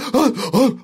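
a high, sudden intake of breath as panic hits; sharp panicked gasp. Retold or imitated. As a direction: gasp like the air just ran out. Panic attack starting in story.

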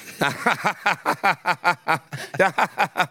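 high, forced staccato laughter; put-on laugh in repeated high bursts; weird fake laugh. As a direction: imitate a long, awkward fake laugh. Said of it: "doing that weird sort of fake laugh".

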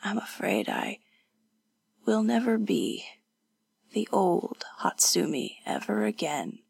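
soft and halting, barely voiced; weak, despairing whisper. Wounded woman doubting she will recover, despairing whisper. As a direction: falter through it with long gaps.

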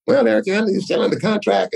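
high nasal John Shaw impression; nasally voice. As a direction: squeeze it through the nose, nasal. Voicing the manager John Shaw.

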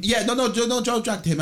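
high, loud insistence; emphatic correction. Real, not acted.

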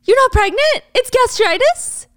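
loud, very high disbelieving echo; incredulous questions. Acted. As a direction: throw it back in outraged disbelief. Acted boyfriend stunned by the reveal.

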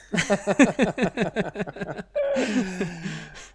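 drawn-out high chuckling laugh; long laugh. Real, not acted. Host cracking up after asking his co-host if he's a liar, long laugh. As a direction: laugh helplessly at your own question.

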